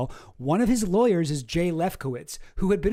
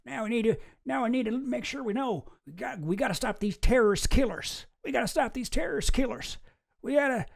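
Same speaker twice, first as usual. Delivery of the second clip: George Bush impression; 6 semitones higher than usual. Vlogger trying out an old George Bush accent. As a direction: do your best George W. Bush, Texan twang. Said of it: "let me see if I can do it".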